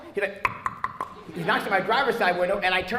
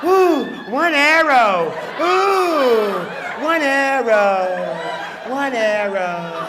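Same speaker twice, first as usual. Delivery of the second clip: put-on swooning girlfriend; 3 semitones higher, 7 dB louder, much slower than usual. Comic voicing girlfriend, slowly swooning over the boast. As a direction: melt over him like a smitten fan.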